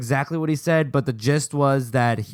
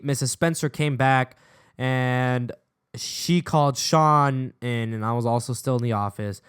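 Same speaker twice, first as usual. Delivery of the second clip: usual speaking voice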